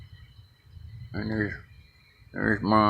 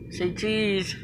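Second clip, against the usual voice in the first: soft falsetto coaxing; high soft coax; 11 semitones higher, 7 dB quieter than usual. Real, not acted. Filming birds, coaxing them to pose in a soft high voice. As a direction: coo it up high and quiet for the camera.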